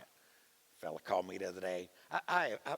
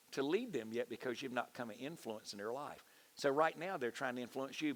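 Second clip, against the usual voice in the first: ordinary talk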